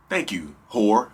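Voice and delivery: slow, low smug mock thanks; lightly voiced sneering abuser. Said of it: "They'll be like".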